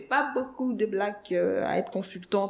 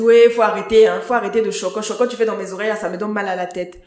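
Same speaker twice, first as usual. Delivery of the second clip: loud mock telling-off, easing toward the end; retold aggressive scolding; 9 dB louder than usual. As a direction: imitate a fed-up taximan, loud outburst first. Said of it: "C'est tellement violent".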